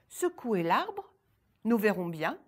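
put-on girl defying the ogress from the tree, loud; defiant cry. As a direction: call down boldly. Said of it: "la petite s'écria".

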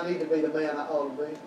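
ordinary delivery

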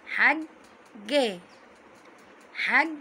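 "حج" split as hag, then ga; doubled letter demonstration. Shown as a demonstration. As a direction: hold up on the g of "حج", then release ga. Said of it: "ننطق الحرف على طول ساكن".

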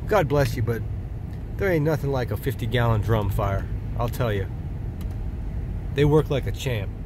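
ordinary delivery

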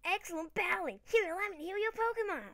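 high, loud mock American nurse voice; bad American accent. Gamer voicing the Pokemon healer, bad American accent. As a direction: overdo a cheery American accent. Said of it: "in your horrible American accent".

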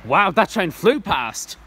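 real excitement, drawn out and loud; excited wow. Real, not acted. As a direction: marvel at the speed of the train. Train just rushed through station.

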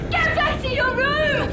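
high, loud yell. Acted. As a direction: order him out, full force. Yelling at child.

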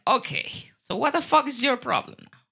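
indignant retort in a retelling; retold angry comeback. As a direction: fire back, fed up, pitch way up.

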